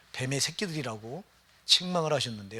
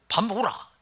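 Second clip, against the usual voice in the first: much higher, louder Gyeongsang call that sounds like a fight; Gyeongsang daughter to mother; 8 semitones higher, 5 dB louder than usual. Retold or imitated. Daughter calling mother to eat, mock Gyeongsang accent. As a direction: bark it out in a blunt Gyeongsang accent. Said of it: "딸이 엄마한테 말해".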